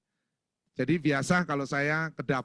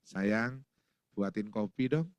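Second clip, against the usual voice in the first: soft, low sweet request; slow-breaking, hushed endearment; gentle; 7 semitones lower, 3 dB quieter, slower than usual. Shown as a demonstration. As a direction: coax your wife tenderly for coffee. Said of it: "Ngomong yang lembut".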